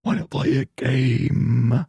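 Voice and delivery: slow, drawn-out villain impression; Jigsaw. Streamer obliging chat's comparison, slow menacing villain voice. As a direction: draw out the last word like a horror villain. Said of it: "You sound like Jigsaw".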